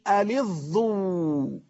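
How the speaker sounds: ألظوا with a heavy doubled ظ and long final u; doubled, emphatic ظ. Shown as a demonstration. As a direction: draw out ألظوا, doubling the ظ with a damma. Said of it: "بالتشديد والضم على الظاء". («ألظوا» بتشديد الظاء وضمها ومدّ آخرها)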